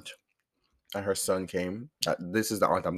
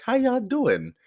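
playful greeting; 4 dB louder, slower than usual. Acted.

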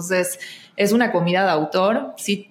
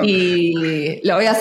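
ordinary delivery

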